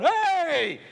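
high, drawn-out "hey"; re-enacted yell. Preacher interrupts drunk man hitting someone, high-pitched yell. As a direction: yell to break up a fight. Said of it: "I yell into the microphone".

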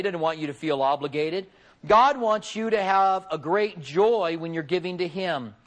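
usual speaking voice

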